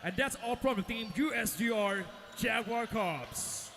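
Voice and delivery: ordinary talk, nothing marked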